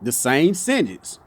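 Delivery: ordinary talk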